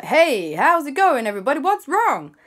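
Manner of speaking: loud, high, breezy hero greeting; cheery pilot. Acted. Stunt pilot greets the family, loud and chirpy. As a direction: swoop in all friendly and bold.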